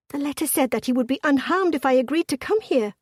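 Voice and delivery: urgent, panicked protest. A narrator's reading. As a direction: argue loudly through rising panic. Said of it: "her voice sounded panicked".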